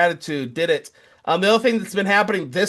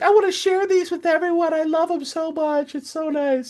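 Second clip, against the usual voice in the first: wife quoted gushing over her songs; 12 semitones higher than usual. Retold or imitated. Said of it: "now she's like".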